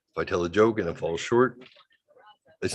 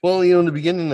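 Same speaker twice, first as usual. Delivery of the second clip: higher, louder girlfriend impression; imitating girlfriend; 8 semitones higher, 7 dB louder, faster than usual. Retelling what his girlfriend said, in a lightly voiced imitation. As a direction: slip into her voice, a bit bright and eager. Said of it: "the love of my life said".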